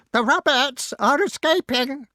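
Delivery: high, loud crow shriek; frantic bird squawk; screaming crow. A narrator's reading. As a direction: sound the alarm as a screeching crow. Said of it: "screamed the crow".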